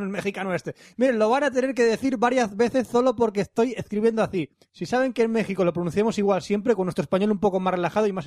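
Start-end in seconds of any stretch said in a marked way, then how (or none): 1.0-4.5 SOLO and ASÍ said with th for each S; exaggerated Z lisp. Host rereading a Mexican's Z-spelled email, exaggerated lisp. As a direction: overdo the th in "escribiendo así". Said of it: "ha escrito con Z todo".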